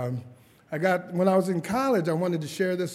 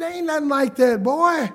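put-on higher, louder old grandma scolding; grandma's voice; 9 semitones higher, 8 dB louder than usual. Grandma answers her grandson on the phone, higher and louder. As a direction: play grandma brushing off the question. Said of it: "She said".

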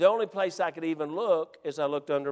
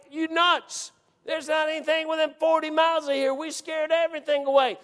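high, exasperated full-voiced protest; talking out loud; 9 semitones higher than usual. Hunter scolds his whispering buddy, exasperated out loud. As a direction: abandon the hush and holler in disbelief. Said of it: "I'm talking out loud".

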